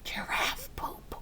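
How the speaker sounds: whispered giggly secret. Real, not acted. Reader telling children the rude word, whispered. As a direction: whisper the word like it's naughty. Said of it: "whispering a naughty word".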